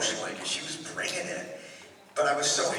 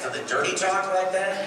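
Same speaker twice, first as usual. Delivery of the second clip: hammed-up announcer voice, higher and louder; 8 semitones higher, 4 dB louder than usual. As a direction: ask it like a booming sportscaster. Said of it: "do they always sound like this".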